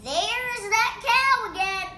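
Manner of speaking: high, loud shout. Acted. Bean shouts over noise.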